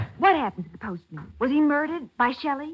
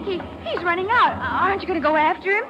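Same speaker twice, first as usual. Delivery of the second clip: high, urgent and pressing; alarmed urging; 6 semitones higher, 4 dB louder than usual. Acted. Patsy urging Nick as the suspect flees, alarmed. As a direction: push him to give chase.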